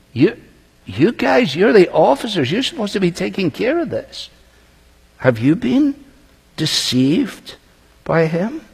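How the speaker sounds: lightly voiced Pharisees, exasperated with their own men; indignant Pharisees. Pharisees scolding their officers.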